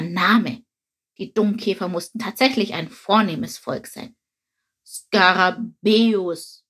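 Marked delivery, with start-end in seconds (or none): 4.9-6.6 Skarabäus said slowly and evenly, each syllable spelt out; unmoved repetition. A narrator's reading. Beetle calmly corrects the sparrow, saying Skarabäus slowly. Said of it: "wiederholte der Dungkäfer ungerührt".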